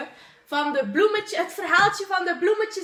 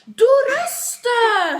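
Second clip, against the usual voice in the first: loud, high, drawn-out call; 9 semitones higher, 8 dB louder, much slower than usual. Real, not acted. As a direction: call it out loud, stretching each word. Calling out during the outro.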